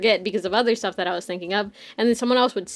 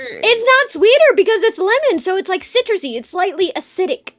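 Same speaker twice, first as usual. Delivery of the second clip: higher and louder, passionately arguing her case; heated defence; 6 semitones higher, 5 dB louder than usual. Real, not acted. Streamer defends her lemon iced tea to a friend, heated and higher. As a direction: insist on it like a tea snob.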